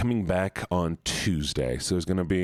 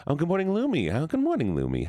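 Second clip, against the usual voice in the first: high sing-song greeting; 10 semitones higher than usual. Real, not acted. Greeting a chat member, much higher.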